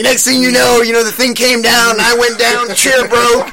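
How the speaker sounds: high, loud Southern storytelling twang; Southern accent. Retold or imitated. Chuck telling Rich how the chair broke, loud Southern accent. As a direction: build the excuse as an imitated Southern guy. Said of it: "He's a Southern guy".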